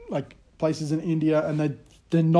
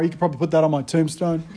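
ordinary talk, nothing marked

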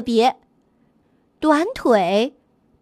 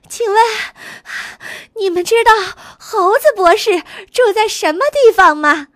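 louder and higher, calling while catching up; 7 semitones higher, 4 dB louder than usual. A narrator's reading. Goose hurrying up to the group, asking loudly. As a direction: call out the question to the group. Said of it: "喊道".

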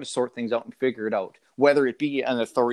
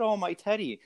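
higher, softer mocking whine on "Oh, my teddy."; 7 semitones higher, 4 dB quieter than usual. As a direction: poke fun at the sad kid's line. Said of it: "And I mocked it".

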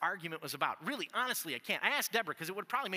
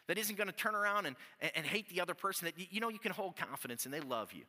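ordinary delivery

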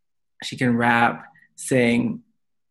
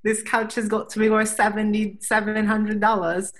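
higher, hammed-up Australian accent; 8 semitones higher than usual. Quoting the Australian guy about the couch, mock Australian accent. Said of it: "I can't do the Australian accent".

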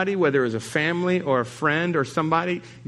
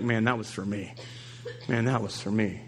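softer, lower, hushed realization; quiet awe; 6 semitones lower, 7 dB quieter than usual. Pretend. Listener at life group realizing the word was meant for him, hushed. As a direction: say it to yourself, moved. Inner reaction to message.

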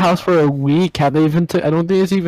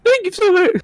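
put-on girl voice. Shown as a demonstration. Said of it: "let me try that again".

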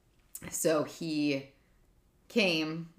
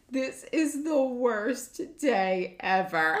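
high, slow, loud mock wail; imitating upset child; 8 semitones higher, 5 dB louder, much slower than usual. Son getting his scraped knee bandaged, mock distraught. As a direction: draw it out like a distraught kid. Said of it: "hysterically crying more".

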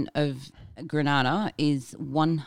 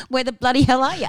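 loud, higher, broad Aussie ad voice; louder, higher mock-Aussie shout; hammed-up ocker Aussie; 8 semitones higher, 6 dB louder than usual. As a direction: belt it like a cheesy outback tourism ad. Aussie tourism ad catchphrase.

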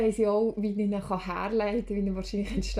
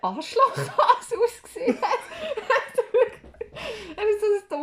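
laughing; 13 semitones higher, 6 dB louder than usual. Real, not acted. Laughing while telling dream.

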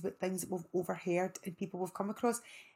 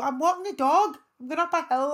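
high, loud panting excuse; mock a pal covering for himself; hammed-up innocent pal; out-of-breath pal; 8 semitones higher, 10 dB louder than usual. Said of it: "He'd go".

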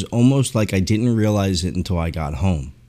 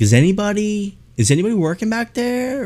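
calling out to staff; 12 semitones higher than usual. Retold or imitated. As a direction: call out in a high, questioning singsong. Acting himself calling to the back.

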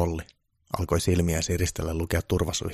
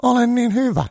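far higher, loud boastful voice; self-assured Trump; 8 dB louder than usual. Acted. Trump boasting to Santa, much higher and loud. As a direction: brag with total confidence. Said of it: "Trump sanoi itsevarmasti".

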